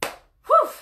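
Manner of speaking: a high, loud exhale after the rant; breathless high whew. Real, not acted. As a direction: blow out the energy in a high whew. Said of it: "sorry, okay".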